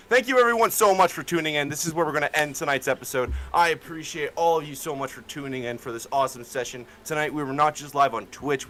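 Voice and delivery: upbeat fast sign-off; energetic host wrap-up; streamer outro. Real, not acted. Streamer outro thanking viewers.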